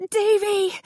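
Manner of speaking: high screamed name; mother screaming for her son. A narrator's reading. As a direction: scream his name from across the street. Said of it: "she screamed".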